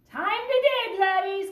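loud, high pirate order; pirate command. A narrator's reading. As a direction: command the crew to dig. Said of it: "Captain commanded".

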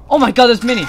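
high, loud panicked cry; panicked shout. Real, not acted. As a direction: yelp in panic. Many enemies appear.

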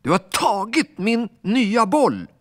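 very high, loud furious shout; furious yell. Acted. Alfons yelling at the ball boy, high and furious. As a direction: scream the accusation at him. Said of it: "blev ännu argare och skrek".

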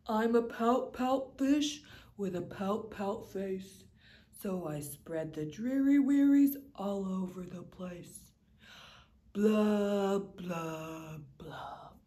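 low, dreary fish moaning his refrain; low mopey fish sighing blah blah; glum pout fish. Acted. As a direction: sink into the gloom of a sulky fish. Fish's gloomy refrain.

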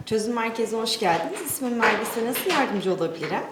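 customer service voice. Acted. Call centre greeting, repeated by agents.

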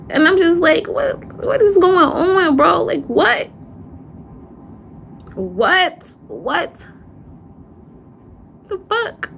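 pitched high, piling up "what"s in disbelief; shocked disbelief. Real, not acted. Reacting to people lying to him, in high shocked disbelief. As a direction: voice climbing as the shock hits.

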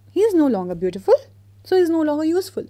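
retold professor. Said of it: "the art professor of the university says".

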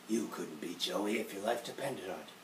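cold rival put-down; Kaiba voice. Retold or imitated. Playing Kaiba mocking Joey, soft sneer. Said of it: "Hey, Kaiba, I could try and be Joey".

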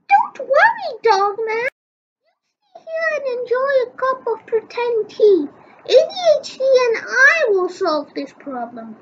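put-on high, quick little girl voice. Girl fusses over Dogman's collar, high and quick.

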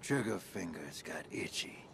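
ordinary talk

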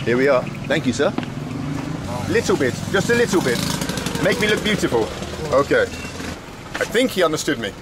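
ordinary talk, nothing marked

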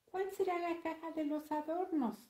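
put-on high-pitched boy's question; questioning little boy. Toñito asks his sister about the boxes, high questioning voice. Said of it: "preguntó Toñito".

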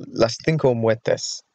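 CINCO with a th, "THEEN-ko"; Castilian pronunciation. Shown as a demonstration. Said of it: "I always pronounced it".

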